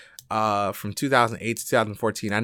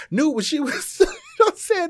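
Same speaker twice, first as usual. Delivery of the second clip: high, laughter breaking into the words; laughing while talking. Real, not acted. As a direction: let a laugh take over mid-quote. Laughs while retelling a quote.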